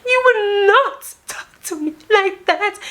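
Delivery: very high wailed protest; mock crying woman. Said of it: "She starts crying".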